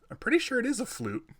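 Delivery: high-pitched mock incredulity, pitch jumping way up; sarcastic disbelief. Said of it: "and I'm like".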